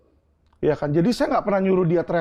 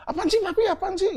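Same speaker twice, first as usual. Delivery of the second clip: much higher-pitched mock protest of a child; imitating reluctant child. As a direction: put on a high voice, pushing a hug away. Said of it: "sekarang saya peluk aja kagak mau".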